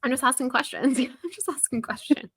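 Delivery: jokey, high-voiced protest; mock innocent. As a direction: play innocent as a running joke. Hosts joke as innocent questioners.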